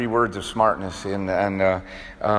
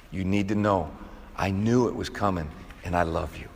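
soft, quiet and gentle, Jesus speaking to Peter; hushed loving reassurance, much softer; tender love; 4 semitones lower, 5 dB quieter than usual. Retold or imitated. Jesus's gaze voiced with love.

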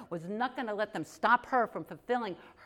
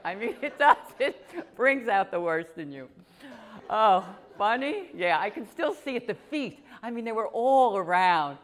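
too much caffeine; 8 dB louder than usual. Real, not acted.

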